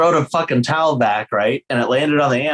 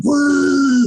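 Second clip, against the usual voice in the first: mock drunk cheer; 13 semitones higher than usual. Imitating a drunk fan cheering.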